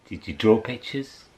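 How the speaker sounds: plain reading voice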